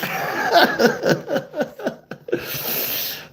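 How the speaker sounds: long, high, real laugh; laughing at himself. Real, not acted. Author cracking up at his own story, real high laughter. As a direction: crack up helplessly at your own joke. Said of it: "I'm laughing at my own shit".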